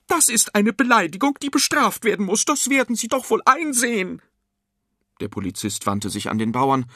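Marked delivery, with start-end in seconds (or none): 0.1-4.2 high-pitched, loud indignant woman; shrill nagging. A narrator's reading. Fat woman demanding the policeman punish the farmer, shrill nagging. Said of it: "keifte die Frau".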